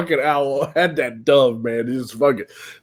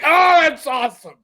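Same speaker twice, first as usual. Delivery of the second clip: high, excited exclamation; high thrilled reaction to the kill; amazed delight; 13 semitones higher than usual. Retold or imitated. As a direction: gush in stunned excitement. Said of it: "And I was like".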